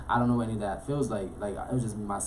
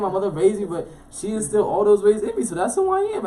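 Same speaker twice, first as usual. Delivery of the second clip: much higher, louder proud statement; proud declaration; 9 semitones higher, 6 dB louder than usual. Real, not acted. Telling a friend he is New York at heart, proud and raised. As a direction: declare it with swelling pride.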